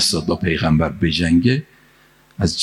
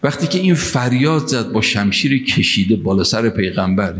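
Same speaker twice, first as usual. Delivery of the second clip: normal talking voice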